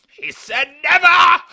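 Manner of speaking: very high, drawn-out mock scream; outraged fan. Podcaster as the guy in the cinema seat, screamed protest. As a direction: shriek it slowly in mock outrage. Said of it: "that guy was in the seat like".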